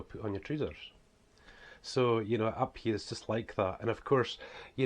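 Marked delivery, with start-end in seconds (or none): 0.0-0.9 broad northeast Scots, "trousers" as "treezers"; broad Scottish dialect. Shown as a demonstration. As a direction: local Scottish way of saying it. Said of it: "that's the way we speak though".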